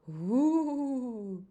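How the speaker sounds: loud ghostly woo; ghost call. Acted. Ghost swooping over the friends, loud woo. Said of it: "It swooped low and called".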